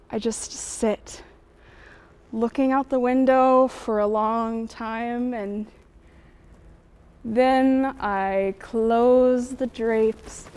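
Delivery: slow, drawn-out words with long pauses; slow, dreamy reverie. Acted. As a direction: drift slowly off into a daydream. Recounting lonely escape into fantasy.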